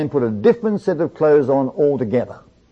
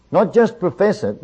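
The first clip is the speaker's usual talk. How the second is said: ordinary talk, nothing marked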